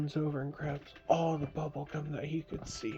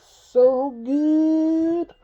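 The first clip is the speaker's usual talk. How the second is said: high, loud, slow delight; 12 semitones higher, 9 dB louder than usual. Acted. Bandit tastes maple syrup gum, high slow delight. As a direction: savor it like the best treat ever.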